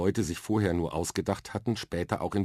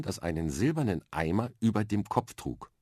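usual speaking voice

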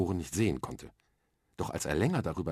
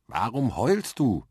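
muffled voice; 5 semitones higher, 5 dB louder, slower than usual. A narrator's reading. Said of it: "fragte plötzlich eine dumpfe Stimme".

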